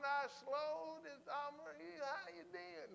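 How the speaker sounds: high, stretched-out Southern mock drawl; slow Southern drawl. Showing what slow to speak doesn't mean, mock Southern drawl. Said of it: "No, he's not talking about that".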